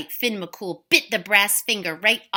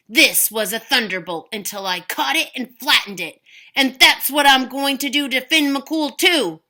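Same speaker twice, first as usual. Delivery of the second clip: threatening giant; 4 semitones higher, 6 dB louder than usual. Acted.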